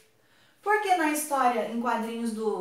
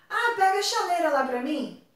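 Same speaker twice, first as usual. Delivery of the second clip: relaxed everyday voice, a touch higher; casual request; 6 semitones higher than usual. Shown as a demonstration. Teacher acting a home request in casual speech. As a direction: ask for the kettle offhand, like at home. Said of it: "Na fala eu posso falar".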